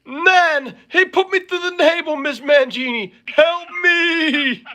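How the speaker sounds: high, mock-pained cry for help; imitated whining victim. Co-host crying to Miss Mangini after being crushed, high mock wail. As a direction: wail like a flattened wrestler, hammed up. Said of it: "and you would say".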